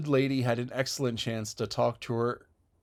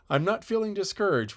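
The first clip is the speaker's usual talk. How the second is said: dry; 9 semitones higher than usual. A narrator's reading. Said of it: "was Marilla's dry response".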